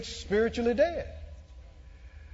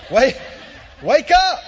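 loud higher rousing shout; shouted wake-up call; 4 semitones higher, 12 dB louder than usual. Shown as a demonstration. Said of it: "Some folk needed that literally".

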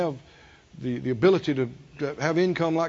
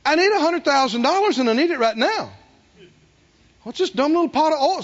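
a hint of a whiny doubter, higher; mock the grumbling unbeliever lightly; voice of unbelief; 6 semitones higher than usual. Preacher mocking unbelief to congregation, slightly higher whine. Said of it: "unbelief and walking by sight goes".

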